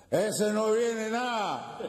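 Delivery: put-on high, drawn-out drunken heckle; heckling drunk. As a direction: call it slowly from the back of the crowd. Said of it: "el que ha gritado".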